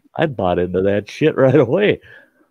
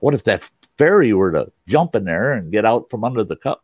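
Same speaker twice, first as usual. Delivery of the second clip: ordinary talk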